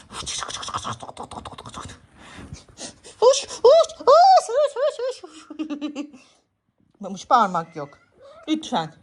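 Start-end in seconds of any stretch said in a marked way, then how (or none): 0.1-6.1 unvoiced hoş-hoş shooing, slowing to a laugh; hissed shoo. Real, not acted. Owner hissing to break up the cats. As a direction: hiss the cats apart.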